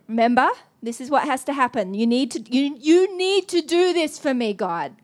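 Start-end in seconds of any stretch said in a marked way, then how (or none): 1.9-5.0 urgent plea. Retold or imitated. She pleads with God for a word, urgent and louder. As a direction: beg God to come through.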